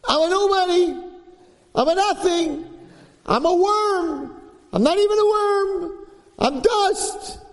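put-on yeshiva boy howling that he's garbage, very high; screaming self-abasement. Yeshiva student screaming in the study hall that he is nothing, in a high wail. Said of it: "he would mamish scream like that".

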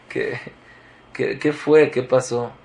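ordinary talk

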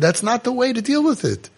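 imitated upset father, high-pitched. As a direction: correct him with mild indignation. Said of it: "Got upset at him".

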